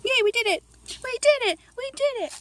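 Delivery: soft cheer. Real, not acted. Cheering baby on, softly.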